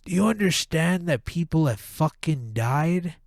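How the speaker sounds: ordinary talk, nothing marked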